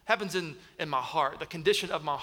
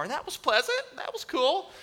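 sarcastic; 8 semitones higher than usual. Real, not acted. Sarcasm about the ER.

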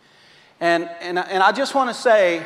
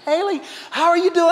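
teasing dad; 11 semitones higher, faster than usual. Retold or imitated.

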